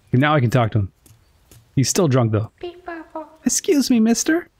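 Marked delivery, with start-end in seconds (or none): 3.4-4.5 put-on much higher, timid child voice; a shy child tugging at a grown-up; polite little kid. The child politely gets the drunk knight's attention, in a high shy voice.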